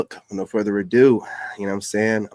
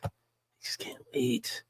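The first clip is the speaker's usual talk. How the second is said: eager whisper; 13 semitones higher, 11 dB quieter than usual. Real, not acted. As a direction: whisper it, barely holding in the excitement. Host eager for more African accents.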